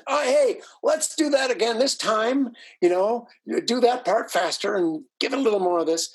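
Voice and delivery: high, eager mock director voice giving notes; imitating the director. Guest imitating his director giving rehearsal notes, high and eager.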